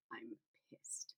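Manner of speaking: unvoiced, soft, angry hiss. She hisses at Trey that she is angry. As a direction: hiss it through your teeth. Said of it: "I hissed".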